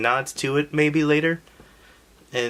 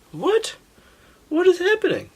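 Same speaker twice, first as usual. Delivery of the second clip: much higher, louder bewildered cry; mock shock; 4 dB louder than usual. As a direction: act baffled at the twist. Mock shock at second Zelda.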